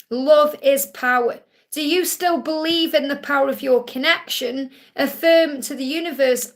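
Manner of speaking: ordinary talk, nothing marked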